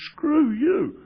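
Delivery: loud, high outburst of defiance; retold angry retort. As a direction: snap it out high and loud, re-enacting an argument. Angry retort to friend on phone.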